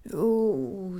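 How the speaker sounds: ordinary talk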